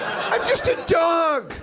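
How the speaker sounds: dog's voice. Acted. Voicing Hitler's dog.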